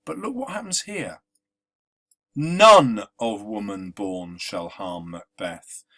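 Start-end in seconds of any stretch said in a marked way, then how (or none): 2.3-5.8 None hit hard and slow, the first syllable stressed; slow and louder, leaning on None at the start; trochaic stress. Shown as a demonstration. Teacher showing the broken rhythm, stressing None. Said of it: "here we have a trochaic stress".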